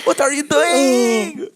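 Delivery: high, loud, long-stretched wail; Rocky Balboa. Acted. Mocking co-host's Italian Rocky, slow high yell. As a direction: drag out every word in a mock yell. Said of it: "that's a good impersonation of Rocky Balboa".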